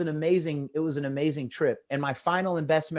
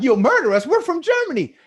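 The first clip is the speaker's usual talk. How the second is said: high, loud mocking voice; mock German taunt; 11 semitones higher, 6 dB louder than usual. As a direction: throw the boast back at them. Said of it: "they were like".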